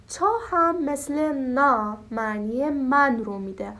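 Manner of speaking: ordinary delivery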